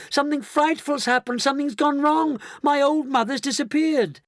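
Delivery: very high, loud panic; crying out. A narrator's reading. Daughter calling Mister Wonka as mother vanishes, panicked. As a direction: cry frantically for help, high-pitched. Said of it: "Missus Bucket cried out".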